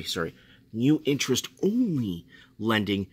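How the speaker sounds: usual speaking voice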